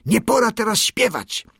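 high, snapping rebuke; angry shout. A narrator's reading. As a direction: cut the bird's song off angrily. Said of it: "krzyknął gniewnie".

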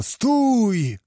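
high, drawn-out cry to halt; anguished cry. A narrator's reading. Kmicic halting the executioners, long high anguished cry. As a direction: cry out as if being impaled yourself. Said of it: "krzyknął tak, jakby jego samego na pal nawłóczono".

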